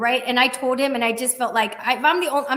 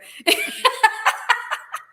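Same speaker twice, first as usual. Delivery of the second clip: airy giggle; breathy laugh; 12 semitones higher, 3 dB louder than usual. Real, not acted. Laughing at hotel suggestion.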